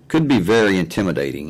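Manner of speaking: normal talking voice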